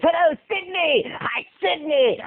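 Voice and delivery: high, stretched-out villain greeting; alternate audition take. Shown as a demonstration. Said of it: "I did like a thousand ways".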